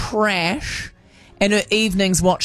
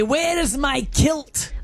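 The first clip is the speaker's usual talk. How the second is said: mock Scottish; 6 semitones higher, much slower than usual. Shown as a demonstration.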